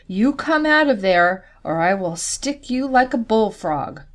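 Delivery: woman warning an intruder in the hayloft, stern threat. Acted. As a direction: threaten whoever hides in the hay. Betsy threatens hidden intruder.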